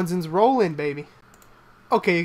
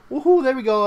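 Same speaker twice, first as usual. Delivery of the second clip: whooping cheer; 6 semitones higher, 7 dB louder than usual. Real, not acted. Celebrating the win.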